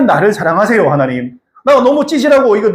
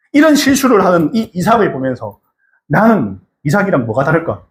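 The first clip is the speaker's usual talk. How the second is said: normal talking voice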